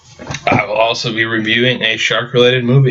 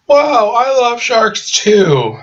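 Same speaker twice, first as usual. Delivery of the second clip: much higher, slow, gushing put-on voice; mock gushing shark girl; 12 semitones higher, much slower than usual. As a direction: squeal it out slowly with fake delight. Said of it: "It's like".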